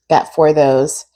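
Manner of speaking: ordinary talk, nothing marked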